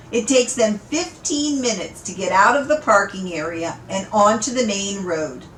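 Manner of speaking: ordinary delivery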